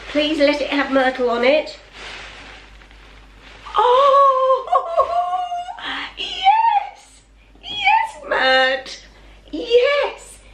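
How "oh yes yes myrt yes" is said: ecstatic shriek. Real, not acted. Owner sees her dog's name on the bowl, shrieking with joy. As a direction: shriek with delight, laughing.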